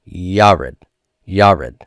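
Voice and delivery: "Yared" twice, each YAH-red with a strong YAH; stress on first syllable. Shown as a demonstration. Teacher demonstrating the accent above the vowel, first syllable stressed. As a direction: hit the first syllable of "Yared", second falling away. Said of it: "put that accent above the vowel".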